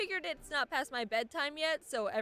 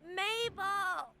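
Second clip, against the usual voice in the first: yelling lady; 7 semitones higher, much slower than usual. Retold or imitated. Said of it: "some lady was yelling".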